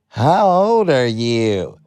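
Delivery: drawn-out questioning by club owner. Retold or imitated. Club owner to a fourteen-year-old, suspicious challenge. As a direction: stretch it out, sizing up a youngster.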